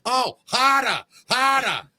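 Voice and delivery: high-pitched Boston accent, hammed-up moaning; mock Boston woman. Boston woman mid-orgasm to her lover, mock Boston accent. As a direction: imitate a Boston woman in ecstasy, stretched and high. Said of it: "You ever hear a Boston woman have an orgasm?".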